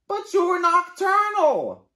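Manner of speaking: very high, loud protest; indignant bird. Acted. Bird protesting Raccoon's bedtime, indignant. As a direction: object with big indignation.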